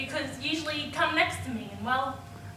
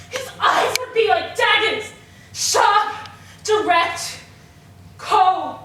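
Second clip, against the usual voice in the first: loud, each word driven home; heated emphasis; 4 semitones higher, 9 dB louder, slower than usual. Acted. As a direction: hammer out the description word by word. Still worked up after the yell.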